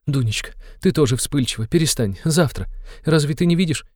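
high, anxious mother's voice; frightened. A narrator's reading. Mother calms her quick-tempered daughter, frightened. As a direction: hush her in a high, worried voice. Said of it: "перепугалась мать".

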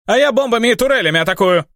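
high, squeaky little gnome voice. Acted. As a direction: pipe it up like a tiny gnome. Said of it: "пропищал гном-инженер Джокер".

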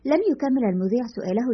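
usual speaking voice

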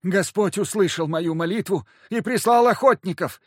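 high, loud wailing priest. A narrator's reading. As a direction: wail it out like a frightened priest. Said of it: "возопил священник".

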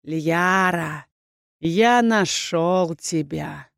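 slow, drawn-out menacing villain voice; sinister villain. A narrator's reading. Rebel leader finds Liyara in the fog, sinister. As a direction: gloat slowly over the found prey. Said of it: "прозвучало знакомым мне голосом".

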